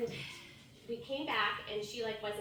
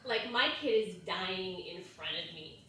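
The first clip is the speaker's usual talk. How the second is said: ordinary talk, nothing marked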